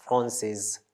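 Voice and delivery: FRANCIS as fron-sez, ending sounded; feminine pronunciation. Shown as a demonstration. Teacher repeating the feminine form, sounded ending. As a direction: say Francis with a buzzing final z. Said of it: "gives S the sound S".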